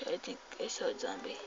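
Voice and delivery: hushed, very fast murmur; quiet nervous aside. Real, not acted. Player spots a zombie, quiet hurried aside. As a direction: say it fast under your breath. First take, low to self.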